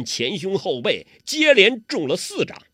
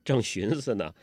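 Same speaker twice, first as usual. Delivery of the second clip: ordinary delivery, nothing marked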